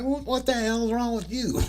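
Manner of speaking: high-pitched imitation of an outraged man; mock outrage. Imitating the man confronting the prankster over his friend, mock indignant.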